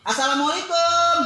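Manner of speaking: a friendly drawn-out phone hello; cheerful loud greeting. Shown as a demonstration. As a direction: greet warmly and loudly down the phone. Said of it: "Beda kan?".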